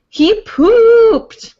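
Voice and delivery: loud, high, drawn-out cheer; crowing with exaggerated glee; triumphant announcement. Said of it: "Like that".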